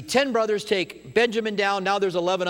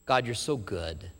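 soft, slow, lower gratitude; grateful sigh; 6 semitones lower, 5 dB quieter, slower than usual. Acted. Believer humbly thanking God, soft and slow. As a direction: melt in grateful awe.

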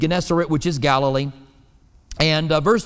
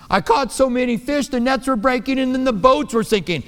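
high, eager boast; bragging fish tale; 6 semitones higher than usual. Retold or imitated. Fisherman boasting to people about his catch, excited. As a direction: brag like a thrilled angler. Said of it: "fish tale you want to tell people".

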